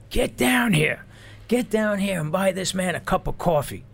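imitated sergeant's shout, high and loud; loud, high bellowed order; screaming sergeant. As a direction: holler for someone to come down, high-pitched. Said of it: "sergeant starts screaming".

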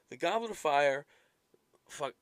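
ordinary delivery

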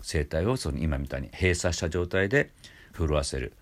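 usual speaking voice

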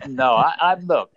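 loud, insistent protest in a retold pep talk; emphatic retold objection. Retelling his firm pep talk.

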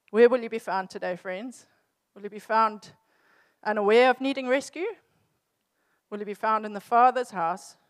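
building rhetorical questions to the congregation; preaching. Acted. Preacher asking the congregation, with rising force. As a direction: press each question home to the listeners.

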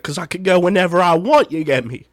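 lightly put-on Cockney London retort; mild mock Cockney London comeback; Cockney reply. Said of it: "my British accent's a bit good there".

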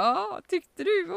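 high, slow, amused reply; amused Norwegian mother. Retold or imitated. Norwegian mother to the hesitant bather, slow and high. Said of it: "Hon bara".

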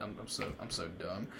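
low, very soft mumble; muttered self-reproach. Retold or imitated. Storyteller thinking he barged in on friend, muttered self-reproach. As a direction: mutter it to yourself, sinking down.

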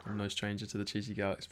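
usual speaking voice

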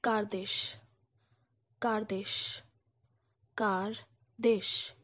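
"Kardeş" with a rolled r and a final sh; Turkish kardeş. Shown as a demonstration. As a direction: Turkish for sibling, spoken clearly. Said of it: "sibling in Turkish sounds like this".

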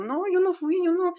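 high, whiny denial from a caught schoolboy; squeaky schoolboy swearing it wasn't him; scared kid denying. Retold or imitated. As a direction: plead innocence like a cornered kid. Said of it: "como típico chavo meco".

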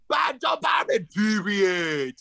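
high, excited shout of recognition; triumphant shout. Real, not acted. Guest finally recalling the forgotten name, triumphant shout.

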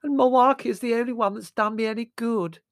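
high, loud lament; wailing. A narrator's reading. Margot on the only one who helped her, wailing. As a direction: bewail it in hammed-up misery.

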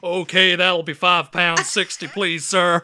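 loud, slow mock-American cashier; exaggerated American accent, hammed up. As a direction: parrot the customer in a booming American accent. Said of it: "sounds like you're making fun of them".

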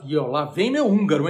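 ordinary talk

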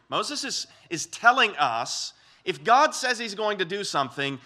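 ordinary delivery

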